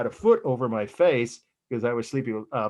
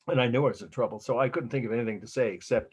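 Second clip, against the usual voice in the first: normal talking voice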